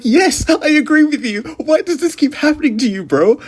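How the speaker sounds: high, sing-song fake agreement; mock sympathy. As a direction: pitch it way up, sarcastic.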